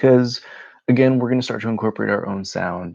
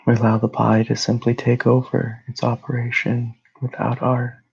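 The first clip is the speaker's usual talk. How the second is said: calm, even meditation voice with long pauses; guided meditation; 2 semitones higher than usual. Real, not acted. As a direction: guide them gently into rest, phrase by phrase.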